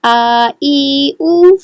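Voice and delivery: slow, drawn-out syllable drill; slow drill reading. Shown as a demonstration. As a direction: read each sound slowly and clearly like a Qaida teacher. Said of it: "اس کو ہم ریڈ کریں گے".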